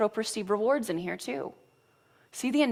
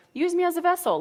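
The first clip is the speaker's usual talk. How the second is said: higher-pitched retold cry for help; high retold plea; 9 semitones higher, 4 dB louder than usual. Retelling own cry for help.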